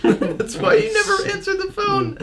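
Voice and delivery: high teasing words through laughter; laughing. Real, not acted. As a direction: tease while giggling.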